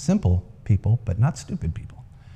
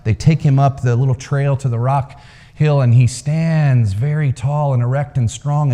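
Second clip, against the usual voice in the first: ordinary talk, nothing marked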